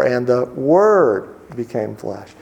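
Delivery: very slow, "Word" carried as the peak; slow and reverent, weight on "Word"; stress on Word. Shown as a demonstration. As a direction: savor "Word" slowly. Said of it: "put the emphasis on a different word every day".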